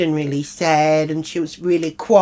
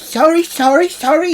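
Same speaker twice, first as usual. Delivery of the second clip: high, loud squeaky sorry; mock apology; 14 semitones higher, 8 dB louder than usual. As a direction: mimic a flustered housemate apologising. Jill apologising, mimicked.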